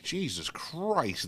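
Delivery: high, drawn-out exclamation; mock amazement. Host reacting to a friend's strong accent, mock amazed. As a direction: stretch it out in playful disbelief.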